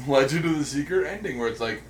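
ordinary talk, nothing marked

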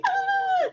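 high fangirl squeal; excited squeal. Retold or imitated. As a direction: squeal like a teen fan. Teen girls squealing at song.

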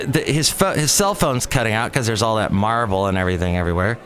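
ordinary talk

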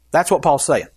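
usual speaking voice

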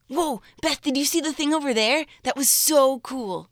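put-on stoked teen boy pointing something out; high, amped skater kid; teenage boy voice. Shown as a demonstration. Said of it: "This is, like, my teenage boy voice".

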